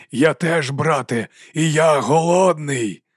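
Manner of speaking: slow, drawn-out dragon voice with an odd timbre; hungry dragon. A narrator's reading. Dragon answering its brother, slow and hungry. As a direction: stretch each word like a waking beast.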